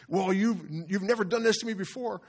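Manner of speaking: high, comic donkey complaint; talking donkey quote. Retold or imitated. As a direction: imitate an aggrieved donkey, pitched well up. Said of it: "talks to him like it's a normal conversation".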